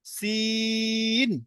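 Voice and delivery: সিন drawn out long and thin against the teeth; long thin sin. Shown as a demonstration. Teacher modelling সিন for students. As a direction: stretch সিন for three alif. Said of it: "সিনটাও তিন আলিফ টান".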